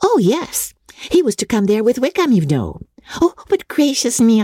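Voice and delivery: high, excited gush that checks itself and slows; a silly girl who let a secret slip; giddy Lydia blurting. Acted. Lydia blurting then catching herself.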